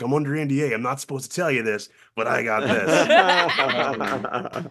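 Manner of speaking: laughing, slowed secret reveal; giddy confession. Retold or imitated. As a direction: let the secret spill out. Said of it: "and I'm like".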